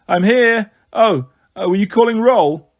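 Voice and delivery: sleepy, loud "I'm here"; half-asleep camper calling out; drowsy call-out. A narrator's reading. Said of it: "a drowsy voice in the crowd called out".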